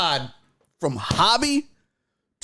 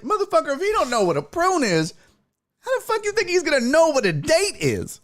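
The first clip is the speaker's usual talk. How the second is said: high, incredulous rant; high exasperation; 12 semitones higher than usual. Retold or imitated. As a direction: squeal in disbelief.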